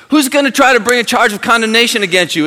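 loud, high pulpit cry; full-force preaching. Acted. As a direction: hammer it home to the congregation.

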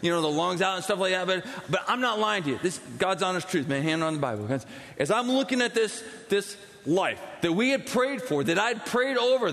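usual speaking voice

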